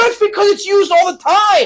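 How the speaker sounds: very high, loud comic protest; exasperated defense. Retold or imitated. Comic defending himself to offended lady, loud high exasperation. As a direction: argue back at full pitch.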